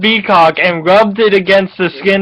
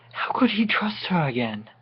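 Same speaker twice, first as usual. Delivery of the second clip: hushed whisper; a mock-tragic whisper; dramatic whisper; 13 dB quieter than usual. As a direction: whisper it like a wounded thought. Dramatic reading.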